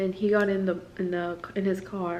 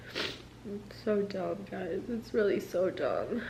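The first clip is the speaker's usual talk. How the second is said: much softer, slow and deflated; quiet despair; 8 dB quieter, slower than usual. Real, not acted. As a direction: let the words sink, worn out. Deflated after venting frustration.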